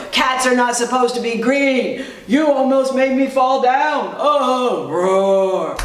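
mock-angry storybook lion, drawn-out roars; growling lion. A narrator's reading. Lion scolds the green cat who nearly tripped him, mock roaring. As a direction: growl and roar it out for children. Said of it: "he growled and roared and said".

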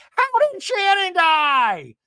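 high, stretched, loud shriek; mock scream. Tamara demanding how Shannon died, imitated screaming. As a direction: screech the question in exaggerated imitation. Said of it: "Tamara screams out".

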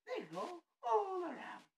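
high, loud, wailing creepy clown; Pennywise. Acted. Showing off a horror clown character, creepy high wail. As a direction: stretch it out in a piercing, slow clown squeal. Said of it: "I enjoy doing Pennywise".

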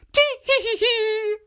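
high, loud tittering giggle; old woman giggle. Acted. Old woman hiding behind the sleeve giggling high. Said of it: "still laughing".

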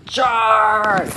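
loud charging yell; rally everyone into attack; battle cry. Pretend.

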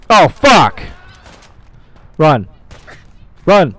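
loud, high yells in bursts; panicked shout. Real, not acted. Player fleeing in a game fight, panicked shouting. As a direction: shout it as you bolt from danger.

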